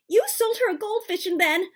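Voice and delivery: sputtered. A narrator's reading. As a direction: blurt it out, upset and accusing. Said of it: "Ma sputtered".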